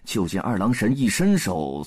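ordinary delivery, nothing marked

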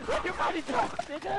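loud high taunt; taunting shout. Real, not acted. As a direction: yell it at your friend. Yelling taunt at friend in game.

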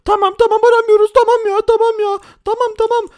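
terrified teller handing over money; begging hostage. Acted. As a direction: beg for your life in a squeaky voice. Hostages promising not to call police.

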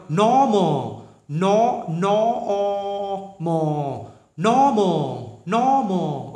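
Normal said very slowly. Shown as a demonstration. Teacher slowly modelling normal for class. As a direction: drag out each syllable of normal.

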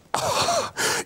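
high hammed-up sob; theatrical high-pitched blubbering; mock sob. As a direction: fake a wail on cue. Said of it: "it's working already".

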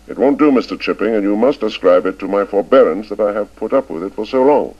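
cold, clipped headmaster reproof; icy. A narrator's reading. Headmaster scolding old Chips, icy and cutting. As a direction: lay it out coldly and deliberately. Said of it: "till at last Ralston said icily".

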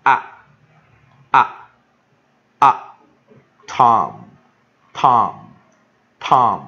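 loud, isolated short "啊" sound, then "Tom" built on it; punched-out teacher's model of "啊" and "Tom"; short vowel demo. Shown as a demonstration. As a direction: drill the short O loudly, each "Tom" slow and separate. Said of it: "所以O要念什么".

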